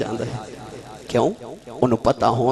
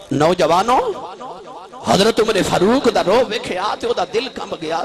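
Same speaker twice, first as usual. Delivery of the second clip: loud, raised preacher's exclamation; rousing call; 5 semitones higher, 3 dB louder than usual. Real, not acted. Preacher rousing young men as he tells the tale, rousing call. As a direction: call out to the crowd, pitch climbing.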